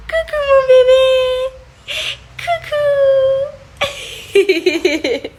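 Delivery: very high, drawn-out cooing greeting. Real, not acted. Mother saying hello to her baby, high squealing coo.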